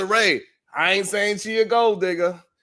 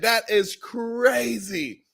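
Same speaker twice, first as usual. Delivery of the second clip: drawn-out disbelief; 5 semitones higher, much slower than usual. Real, not acted. Slow stunned disbelief.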